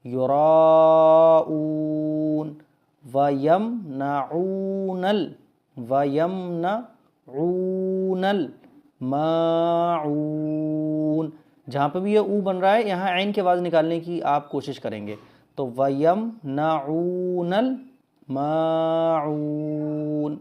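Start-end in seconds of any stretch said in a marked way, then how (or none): 0.0-2.5 "یراؤون" with a very long held raa; drawn-out recitation. Shown as a demonstration. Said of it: "را کو زیادہ کھینچنا ہے". 3.1-11.3 measured tajweed recitation for learners; Quran recitation. Shown as a demonstration. Slow Quran recitation demo. 15.8-20.4 measured tajweed recitation for learners; Quran recitation. Shown as a demonstration. Slow Quran recitation demo.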